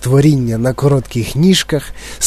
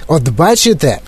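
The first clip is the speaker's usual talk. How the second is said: ordinary delivery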